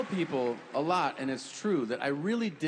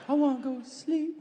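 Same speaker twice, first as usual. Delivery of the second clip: whiny high voice; 11 semitones higher than usual. Pretend. Mocking drug user whining.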